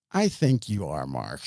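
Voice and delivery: low, measured retold challenge; knowing challenge. Recalling confronting a man denying his name, low knowing challenge.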